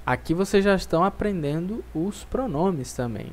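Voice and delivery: ordinary talk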